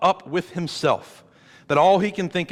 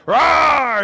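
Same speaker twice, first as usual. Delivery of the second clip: big mock roar for the kids, higher and louder; hammed-up playful growl; playful monster roar; 10 semitones higher, 7 dB louder than usual. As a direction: roar like a pretend monster chasing children. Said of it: "you play the game where, like".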